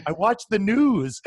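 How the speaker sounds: high, loud and drawn-out mock excuse; worked-up bodega guy. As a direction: imitate a panicked convert, stretching the words. Mimicking bodega guy's changed mind.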